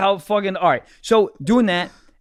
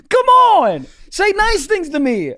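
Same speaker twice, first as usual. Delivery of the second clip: very high, loud comic whine of protest; hammed-up, pleading outburst; exasperated plea; 11 semitones higher, 5 dB louder, slower than usual. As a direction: beg loudly in mock despair. Acted plea after her insults.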